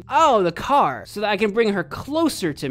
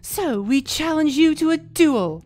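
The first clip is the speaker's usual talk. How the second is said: villain voice; 5 semitones higher than usual. Acted. Villain voice challenging hero.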